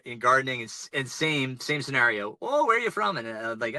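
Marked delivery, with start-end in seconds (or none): 2.4-3.2 imitating a Canadian gardener, pitched up; lightly mocked Canadian accent. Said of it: "they've got the Canadian accent".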